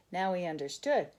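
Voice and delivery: ordinary delivery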